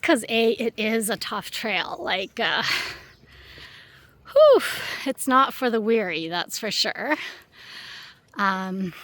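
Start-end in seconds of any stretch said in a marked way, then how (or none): 4.3-4.6 breathless whew. Real, not acted. Climbing steep trail.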